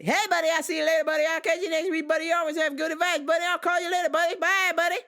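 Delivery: high, loud, fast mimicry of the customer; mock buddy voice. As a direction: parrot his chirpy goodbye. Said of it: "I was like".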